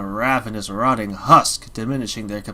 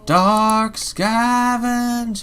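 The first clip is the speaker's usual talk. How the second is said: eerie drawn-out call; 11 semitones higher, 5 dB louder, much slower than usual. Acted. Mysterious entity calls again.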